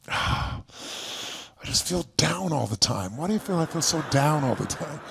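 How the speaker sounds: low, soft sighing whine; imitating a gloomy fan; down and mopey. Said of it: "They'll go".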